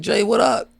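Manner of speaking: high-pitched mock greeting; playful impression of a friend calling out; imitated hail. Herc hails the speaker from a line.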